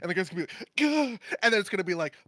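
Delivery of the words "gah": high, sudden cry of pain; pained gasp. Retold or imitated. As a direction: gasp as if hit by an arrow. Guy shot by arrow.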